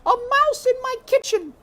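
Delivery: high, loud cry of surprise; cook surprised. A narrator's reading. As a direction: gasp out at the mouse. Cook finds mouse.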